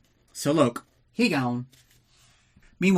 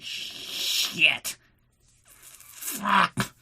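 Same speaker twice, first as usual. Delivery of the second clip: moping; 7 semitones higher than usual. Retold or imitated. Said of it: "he's just moping like".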